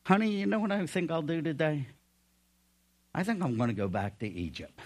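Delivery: mock-casual, quick and breezy chat; Moses. Husband telling his wife his plans, mock casual. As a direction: drop the thunder for offhand, fast small talk. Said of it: "You think Moses said".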